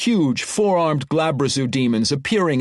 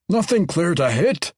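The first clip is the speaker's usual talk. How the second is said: frustrated cry; 4 semitones higher than usual. Acted. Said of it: "Ogden Nugget cried".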